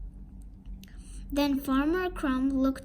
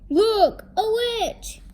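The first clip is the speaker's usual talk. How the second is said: loud, high cry of alarm; alarmed exclamation; 9 semitones higher, 8 dB louder, slower than usual. A narrator's reading. Parents spot the witch, alarmed shout.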